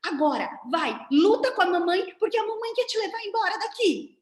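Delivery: acted alarm, pitched high and pressing; urgent inner voice. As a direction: egg a small child on to fight. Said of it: "Pra eles é quase".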